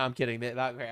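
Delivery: ordinary delivery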